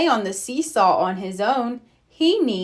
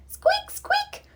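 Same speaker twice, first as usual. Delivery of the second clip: very high squeaking creak; squeaky creak noise; 3 dB quieter than usual. Acted. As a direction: voice a rusty merry-go-round going round. Merry-go-round creaking sound effect.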